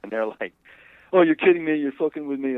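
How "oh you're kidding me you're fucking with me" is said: loud, high mock disbelief; incredulous. Guys reacting to the husband's offer, incredulous. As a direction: burst out as if you can't believe the offer.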